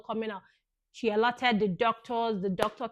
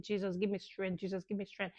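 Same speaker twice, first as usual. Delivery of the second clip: hushed plea; 2 semitones lower, 10 dB quieter, faster than usual. Retold or imitated. Mother retelling her prayer in labour, quiet plea. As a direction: drop the volume and repeat the plea.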